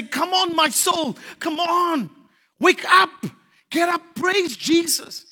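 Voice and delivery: high, loud shouts to wake the soul; rallying shout. Retold or imitated. Preacher quoting David, loud rallying shout. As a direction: call them to their feet. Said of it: "David used to say".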